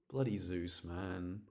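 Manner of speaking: normal talking voice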